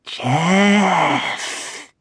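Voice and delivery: eerie drawn-out call of a name; a hint of a ghostly whisper; dark whisper behind you. A narrator's reading. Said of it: "whispered in a low, raspy voice".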